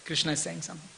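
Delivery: soft, hushed aside; dawning realization. Retold or imitated. Devotee hearing the girl's name Maya, quietly realizing. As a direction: murmur it as it sinks in.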